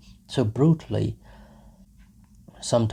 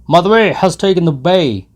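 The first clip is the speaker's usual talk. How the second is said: loud, higher call; shouting from the cart; 8 semitones higher, 13 dB louder than usual. Acted. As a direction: yell it down from the cart. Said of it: "he shouted from the cart".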